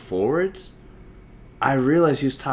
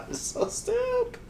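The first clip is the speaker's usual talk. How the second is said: laughing. Real, not acted. Host cracking up at his own rambling, laughing. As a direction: squeeze it out at the tail of a laughing fit.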